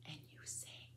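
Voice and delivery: very faint whisper; whispered cue. Real, not acted. Grandmother cueing the child's next line, whispered. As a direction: whisper a cue between lines.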